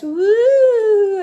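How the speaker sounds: high, loud playful whoop; squiggly-line whoop; playful woo. Acted. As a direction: cheer as the pen swoops. Drawing squiggly octopus legs.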